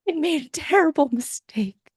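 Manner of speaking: gasping, high panicked confession; mock panicked teenager, high and breathy; panicked teen gasp. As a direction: gasp then blurt it out like a scared teen. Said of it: "the wounded teen is like".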